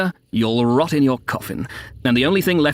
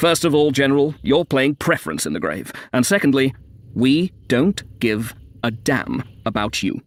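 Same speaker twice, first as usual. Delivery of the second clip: deliberate, word-by-word declaiming; emphasizing each word. A narrator's reading. Klinevich defying the general, each word stressed through "damn about you". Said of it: "emphasizing each word".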